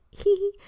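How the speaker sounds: high-pitched mocking giggle. Opponent's mocking laugh.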